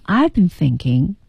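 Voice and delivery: I've been thinking 说得低调缓慢; low-key modest. Shown as a demonstration. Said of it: "很低调". (低调谦逊地说)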